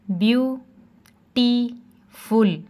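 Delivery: ब्यूटीफुल said very slowly, split into ब्यू-टी-फुल; syllable by syllable. Shown as a demonstration. Teacher showing syllables to students, very slow ब्यूटीफुल. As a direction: stretch ब्यूटीफुल out into its three syllables. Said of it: "ब्यू में देखें यू की भी sound".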